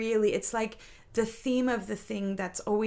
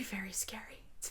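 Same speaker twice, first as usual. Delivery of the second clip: tearful whisper; 3 semitones lower, 14 dB quieter than usual. Real, not acted. Admitting fear of needing people.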